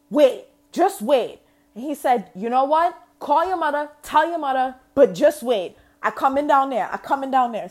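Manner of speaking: hammed-up Trini dad losing his temper, loud and high; angry Trini accent. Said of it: "when he is mad ... accent gets way thicker".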